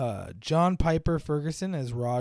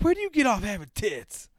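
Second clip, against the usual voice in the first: 1 upset quote; 6 semitones higher, 4 dB louder than usual. Retold or imitated. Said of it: "he's just so upset".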